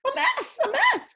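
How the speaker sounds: very high, squawking alarm; crying out. A narrator's reading. Second hen squawking at the pigs' mess, very high. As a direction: hen in a flap over the mess. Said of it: "cried the other".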